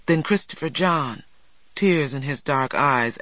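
usual speaking voice

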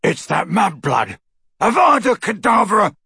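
rising to a loud, high yell; shouted curse. A narrator's reading. Crabbe aims to kill Hermione, shouting. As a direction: build into a furious incantation. Killing curse mid-battle.

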